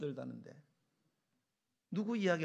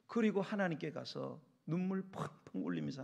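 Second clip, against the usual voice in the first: normal talking voice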